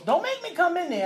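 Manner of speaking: a hint of a scolding mother through the door; old mama warning. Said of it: "I was that old mama".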